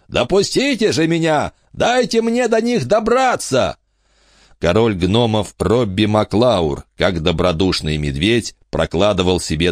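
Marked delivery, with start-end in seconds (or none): 0.1-3.8 high booming demand. A narrator's reading. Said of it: "зычный голос". (зычный голос короля)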